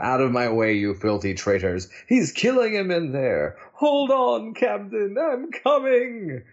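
heroic shout. Acted. Acting out Hercules' comic lines.

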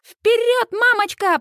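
high-pitched jeering chant; mocking teenage cheer. A narrator's reading. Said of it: "подхватывают подростки".

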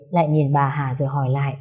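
ordinary delivery, nothing marked

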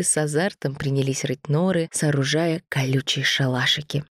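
plain reading voice

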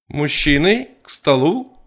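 high, loud call to the table. A narrator's reading. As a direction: call the men in from inside the house. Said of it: "крикнула Ита из дома".

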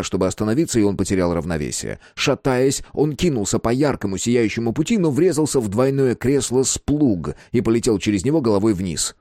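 ordinary delivery

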